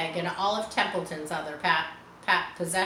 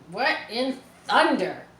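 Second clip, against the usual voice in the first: gruff farmhand; 3 semitones higher, 4 dB louder than usual. A narrator's reading. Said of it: "he said".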